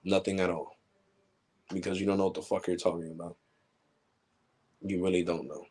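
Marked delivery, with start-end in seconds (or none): none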